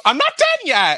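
real insistent outburst; loud protest. Real, not acted. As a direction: cut through the laughter loudly. Cuts off listeners to continue story.

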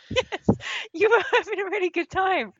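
very high, giggling agreement; words squeaked out through laughter; laughing while talking. Real, not acted. Laughs at partner's joke.

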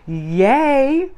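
high, bright little cheer of joy; excited cheer. Real, not acted. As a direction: celebrate the new episode out loud. Announcing new episode.

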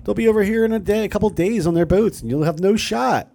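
high, scoffing voice of a doubter; mock loyalist. Imitating the eighty-seven percent scoffing at the colonists. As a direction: sneer at the rebels as a timid loyalist. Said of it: "that were like".

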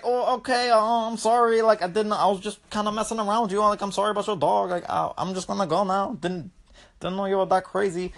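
mock-meek, hurried apology; sheepish monster. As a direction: back down timidly as a scolded creature. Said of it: "as soon as she just let out that yell".

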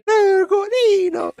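high, slow, drawn-out Italian cry; italian accent portuguese. Pretend. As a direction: wail the name like a hammy Italian. Italian caricature banter.

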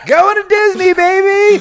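high, loud cheer; excited cheer. Pretend. Telling the host about Christmas plans, loud excited cheer. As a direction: whoop it like a vacation promo. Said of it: "cheering about Disney plans".

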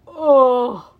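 loud, drawn-out monster groan; moan. A narrator's reading. Dazed Cyclops lies beaten, loud moan. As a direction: groan like a felled giant. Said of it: "Polyphemus moaned".

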